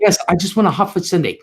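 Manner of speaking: imitating mom's hurried reply; really quick. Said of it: "Said it really quick".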